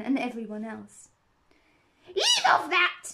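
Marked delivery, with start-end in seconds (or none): 2.1-3.0 loud, high screamed command; screamed order. A narrator's reading. Queen screams at the bowing gardeners. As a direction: scream at them to stop. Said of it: "screamed the Queen".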